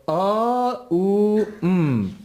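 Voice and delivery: "a, u, mm" as long ah, oo, then hum; three separate intoned sounds. Shown as a demonstration. Teacher recapping the exercise, "a, u, mm" intoned one by one. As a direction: hold each of "a, u, mm", the first two pitched high. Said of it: "That one associated with mm".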